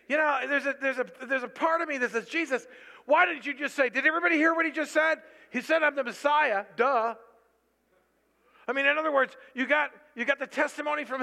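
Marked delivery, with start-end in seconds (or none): 2.3-7.1 loud, high mock-incredulous outburst; exasperated. Preacher voicing his own objection to Jesus, exasperated. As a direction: protest fast and loud as if it's obvious.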